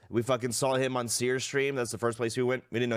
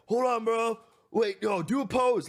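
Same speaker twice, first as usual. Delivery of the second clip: much higher, eager dude voice; mock bro voice; 10 semitones higher than usual. Kid tells his friend to pose by the car, mock eager. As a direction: ham up a kid directing a photo.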